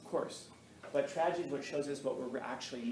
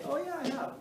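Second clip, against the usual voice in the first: breezy self-quote; 14 semitones higher than usual. Retold or imitated. Teacher answering students who ask about a book, high and breezy. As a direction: toss it back in a high, easy voice.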